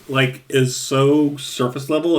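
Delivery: ordinary delivery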